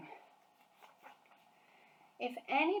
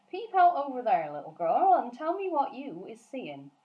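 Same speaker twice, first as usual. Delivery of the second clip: louder, coaxing giant; BFG's voice; 5 semitones higher, 8 dB louder than usual. Acted. Giant telling Sophie to peep out, coaxing giant. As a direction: urge her to look, giant style.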